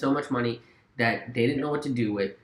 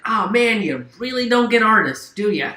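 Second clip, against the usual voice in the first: mocking retort; 7 semitones higher, 6 dB louder than usual. Mock retort to Thatcher.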